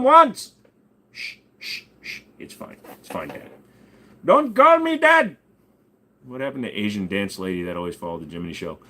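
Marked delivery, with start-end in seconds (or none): 1.1-3.5 soft whispered shushing; hushed whisper. Pretend. Hushing someone. 4.2-5.4 much higher, louder, slow retort; indignant put-on voice. Persona retorts.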